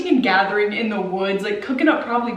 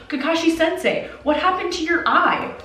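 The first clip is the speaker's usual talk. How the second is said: put-on higher, young student asking; curious kid voice; 8 semitones higher than usual. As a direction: play an eager young ninja pupil. Kids questioning Kakashi, acted.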